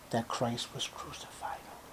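whispered, barely any voice; hushed aside. Acted. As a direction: drop to an awed whisper. Awed aside about the crucifixion.

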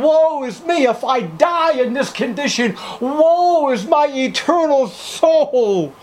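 high, pleading cry for help; desperate sinner. Acted. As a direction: plead like a soul afraid of hell. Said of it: "The sinner comes to the realization".

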